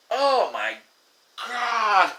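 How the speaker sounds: exasperated disbelief. Retold or imitated. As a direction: roll your eyes at the absurdity. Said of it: "And I'm like".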